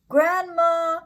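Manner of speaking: high, loud call; wolf hunting for Grandma. Acted. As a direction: burst in calling for her. Wolf bursting in calling.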